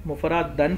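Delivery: مفرد دن read mufradan, with an a in the ending. Shown as a demonstration. Teacher showing a valid reading, مفرد دن ending in -dan. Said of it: "پڑھنا بھی درست ہے".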